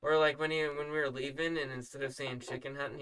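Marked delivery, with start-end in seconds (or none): none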